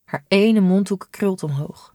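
ordinary delivery, nothing marked

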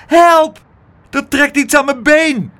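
high, loud scream for help; piercing cry of alarm. A narrator's reading. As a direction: shriek in sudden panic. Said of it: "begon ze plotseling te gillen".

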